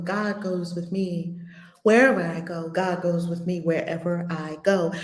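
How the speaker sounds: low, measured mantra recitation; mantra repetition. Shown as a demonstration. Speaker leads listeners saying it seven times, meditative mantra. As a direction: repeat it evenly, pitched low, like a meditation. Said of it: "Let's say it to ourselves seven times".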